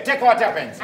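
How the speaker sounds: ordinary delivery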